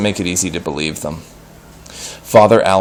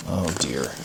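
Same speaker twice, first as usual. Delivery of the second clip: ordinary delivery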